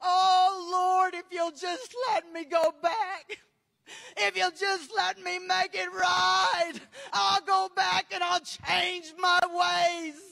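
tearful wail opening on a loud Oh; desperate begging. Retold or imitated. Preacher voicing souls begging to go back, desperate begging. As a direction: plead loudly as a damned soul.

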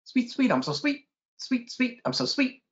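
very high, quick chirped words; high-pitched birdsong in words; sweet warbler song. A narrator's reading. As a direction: trill it like a little yellow warbler. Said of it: "A yellow warbler sings sweetly".

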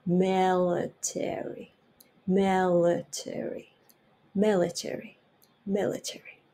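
military slow with the L clearly touched. Shown as a demonstration. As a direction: model military with a crisp L. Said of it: "エルをはっきり". (Lをはっきりmilitary)

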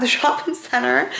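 slow, laughing American "shopping centre"; hammed-up American accent, drawn out with a laugh; exaggerated American accent. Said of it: "Why I've put my American accent on for that".

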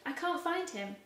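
desperate, searching cry; urgent. Acted. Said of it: "Mary said urgently".

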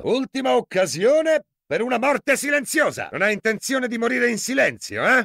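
high, ringing warning; threatening shout. Acted. Cop warning a monster before he kills it, threatening shout. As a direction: bark the last chance at it.

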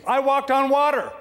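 high, loud proud boast; triumphant shout of a proud fisherman. Acted. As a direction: crow it out to the critics. Peter boasting against critics.

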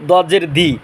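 "দদ জের দি" ending in plain light "di"; mistaken DAD. Shown as a demonstration. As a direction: show the error, "দি" sounding just like dal. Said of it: "একই উচ্চারণ হয়ে যায়".

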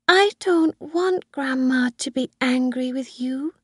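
put-on high-pitched child, subdued; quiet little girl. A narrator's reading. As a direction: confide it like a small girl. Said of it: "she said quietly".

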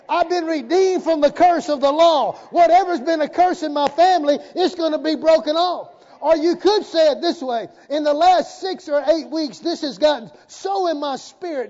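loud, high, driving sermon delivery; full-force preaching. Real, not acted. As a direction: declare it boldly over the congregation.

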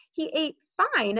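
plain reading voice

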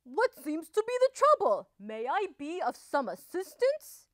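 courteous, high, lilting question; polite offer. A narrator's reading. Wolf politely asking Missus Duck about her trouble, courteous. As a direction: offer help with exaggerated politeness. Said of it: "bowing politely".

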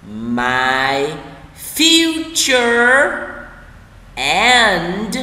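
each word stretched, long gaps between; slow word-by-word. Shown as a demonstration. Teacher models the sentence for learners, word by word. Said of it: "đọc chậm từng từ một".

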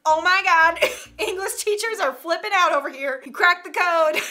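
high, loud, laughing astonishment; mock amazement. Reacting to a clever student's answer, loud mock amazement. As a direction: exclaim as if floored by a discovery.

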